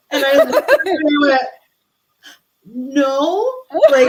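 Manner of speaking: high, loud laugh through the words; giggling disbelief. Real, not acted. Laughing at the prediction.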